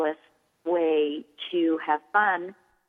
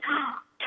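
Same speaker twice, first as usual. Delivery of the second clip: delighted gasp; 8 semitones higher, 5 dB quieter than usual. Pretend. Parent acting delighted surprise.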